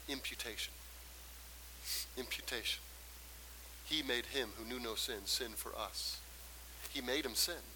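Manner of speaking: very soft, low, with long pauses; hushed preaching. Real, not acted. Preacher lets imputation sink in for congregation, hushed. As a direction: let each phrase sink in quietly. Hushed scripture and key word.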